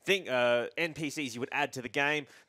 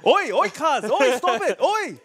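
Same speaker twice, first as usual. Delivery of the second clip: loud, much higher hammed-up oi shouts; mock ward yelling; 10 dB louder than usual. Host hamming up the ward NPC yelling at a player. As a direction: yell oi at a teammate in jest.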